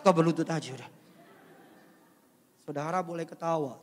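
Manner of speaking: ordinary talk, nothing marked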